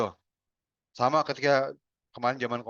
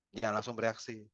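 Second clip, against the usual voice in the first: "Jangan langsung bereaksi" repeated softer, settling; 3 semitones lower, 8 dB quieter than usual. Shown as a demonstration. Trainer repeating advice to audience, softly. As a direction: echo the warning softly.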